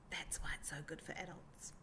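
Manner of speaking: soft, hushed whisper to the grown-ups; whispered aside. Acted. Teacher whispers an aside to adults in the audience, hushed. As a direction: lean in and whisper it like a secret.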